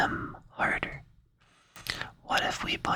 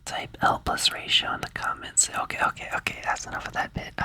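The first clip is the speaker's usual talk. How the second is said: ordinary delivery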